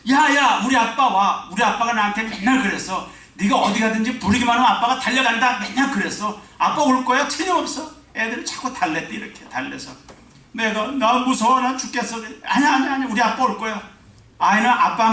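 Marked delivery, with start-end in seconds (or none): 0.1-7.9 put-on high, loud excited child reassuring friends; a child in the rubble insisting dad will come; high child voice, sure of his father. 10.9-12.2 put-on a child's scared cry, high and loud; the trapped kids whimpering they're scared; high voice, mock fear; mock frightened child. 12.4-13.9 put-on high, loud child saying no, no; high child voice, certain. Child reassuring friends.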